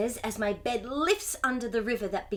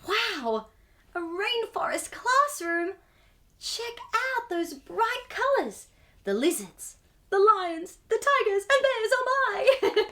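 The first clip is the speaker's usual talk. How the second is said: rising excitement; 9 semitones higher than usual. Real, not acted. Reader thrilling children with the animal list. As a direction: build the list into a delighted cry.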